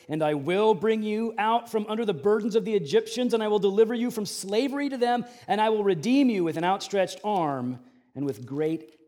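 preaching at full force. Acted. Pastor declaring God's plan to the congregation, loud preaching. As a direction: proclaim it to the whole room.

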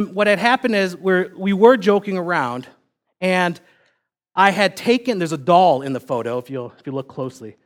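normal talking voice

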